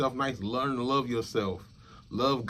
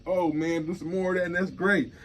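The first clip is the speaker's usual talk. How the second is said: higher, mock-excited compliment; playful higher voice of a friend cheering; hyped-up praise; 7 semitones higher than usual. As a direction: imitate a friend hyping you up.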